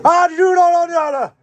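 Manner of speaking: very high, loud holler; hammed-up high-pitched yell; mock shout. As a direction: bellow it high for a laugh. Mock shouting after "shouted Irish" joke.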